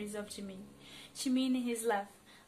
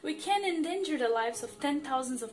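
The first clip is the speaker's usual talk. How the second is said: rising, louder, anguished pleading; desperate plea; 4 semitones higher, 6 dB louder than usual. Acted. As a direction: beg with breaking voice. Pleading for his only person.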